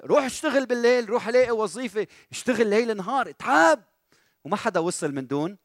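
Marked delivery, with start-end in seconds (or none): 0.0-3.8 high-pitched urging, climbing to a loud peak; forceful exhortation. Acted. Preacher scolds a student who begs for help, forceful exhortation. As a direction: drive the orders home, one after another.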